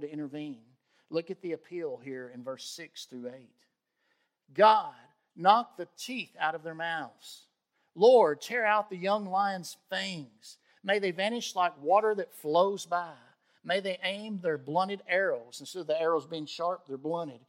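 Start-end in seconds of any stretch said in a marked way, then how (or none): 4.5-15.6 loud, slow preaching of the psalm's curses; fervent scripture reading. Acted. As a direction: cry out the prayer for judgment. Reads psalm with preaching force.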